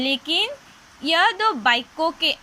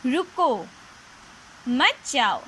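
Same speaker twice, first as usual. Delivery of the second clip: रुको alone, long pause, then मत जाओ; pause after रुको. Shown as a demonstration. Teacher reads first sentence to children, pausing after रुको. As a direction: break after रुको, keep मत जाओ together. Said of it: "पहले वाक्य में देखो".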